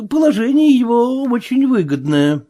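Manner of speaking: high-pitched character voice for Marvel; hesitant Marvel. A narrator's reading. Marvel agreeing with the sailor, hesitant and high.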